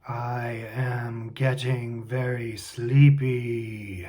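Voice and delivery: slow, drawn-out sleepy trance voice; mock hypnotized. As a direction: drone it out as if falling under hypnosis. Joking hypnotized act before introduction.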